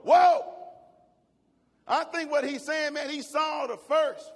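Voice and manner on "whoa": loud, high startled exclamation; startled whoa. Acted. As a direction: blurt it out in shock. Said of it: "you go".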